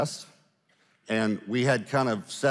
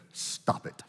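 very soft, whispered command; little voice; 7 dB quieter than usual. Retold or imitated. Elderly lady speaks to the spirit, in a hushed little voice. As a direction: imitate a little old lady, almost whispering. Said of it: "this lady, she said".

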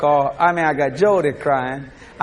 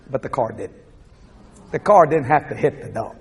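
ordinary talk, nothing marked

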